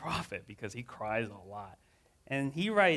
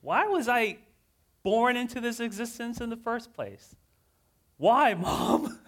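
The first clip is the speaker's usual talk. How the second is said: high, loud complaint to God; anguished outcry; 8 semitones higher, 7 dB louder than usual. Acted. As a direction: pour out every grievance upward. Jeremiah crying out to God.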